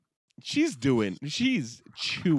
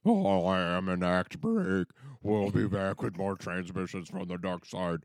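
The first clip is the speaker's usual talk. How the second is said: low monotone mock transmission; ominous drone; 4 semitones lower than usual. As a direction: intone it like a machine, slowing at the end. Droning put-on sign-off into break.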